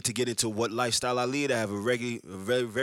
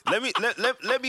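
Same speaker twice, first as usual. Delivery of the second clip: high, giggly restart; higher words breaking with laughter; laughing through words; 11 semitones higher, 3 dB louder than usual. Real, not acted. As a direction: talk while still laughing. Laughing while trying to talk.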